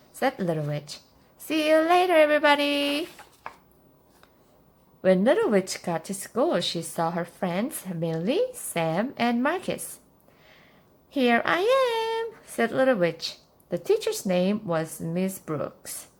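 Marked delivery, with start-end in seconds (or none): 1.4-3.1 high, loud singsong farewell; calling goodbye. A narrator's reading. Child calls goodbye to family. 11.2-12.2 announcing arrival. A narrator's reading. Child announces arrival to friends.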